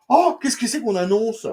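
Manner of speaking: high, loud, gushing oh; practically drooling over the news; excited. Acted. Reviewer teasing the upcoming comics, high and excited. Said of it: "Eh ben, je bave".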